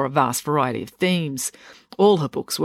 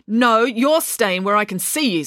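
snapped; 7 semitones higher than usual. A narrator's reading. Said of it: "snapped Mum".

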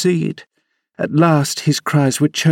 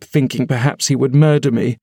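usual speaking voice

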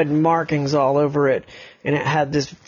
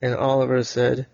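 ordinary delivery

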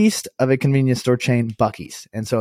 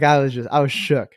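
ordinary talk

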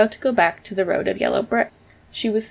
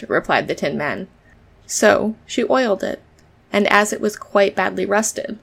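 ordinary delivery, nothing marked

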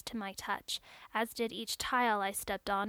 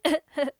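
short voiced laugh; a quick amused laugh; light laugh; 5 semitones higher, 9 dB louder than usual. A narrator's reading. Technician laughs in story.

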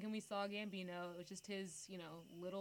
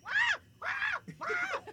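loud high shrieks; repeated screams; 10 dB louder, much slower than usual. Retold or imitated. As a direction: scream over and over, piercing. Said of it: "I was screaming the entire time".

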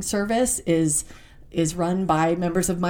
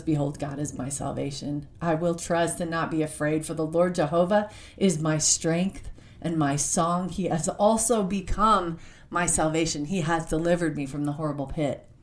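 scripture reading; 3 semitones lower than usual. Acted. Reads Isaiah aloud.

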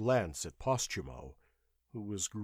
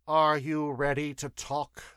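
tired; 5 semitones higher, 6 dB louder than usual. A narrator's reading. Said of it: "asked Demetrio tiredly".

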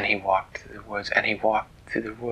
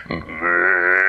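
very long, loud, lower moo; insistent cow; 4 semitones lower, 5 dB louder than usual. Acted. Cow stamping its foot at Dan, long insistent moo. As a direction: hold the moo on and on like an impatient cow. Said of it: "it stamped its foot".

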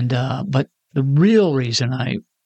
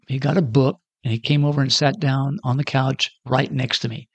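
ordinary delivery

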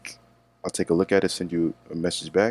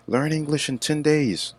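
mocking ad slogan; 9 semitones higher than usual. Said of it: "these YouTube videos or these books".

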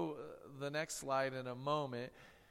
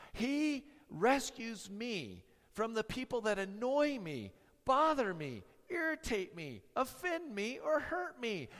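sarcastic; 6 semitones higher than usual. Pretend. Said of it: "with a little sarcasm in it".

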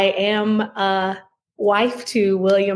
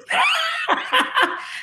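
burst of laughter. Real, not acted. Guest laughs at shared joke.

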